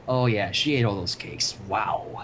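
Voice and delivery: inner voice. Acted. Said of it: "which she thought to herself".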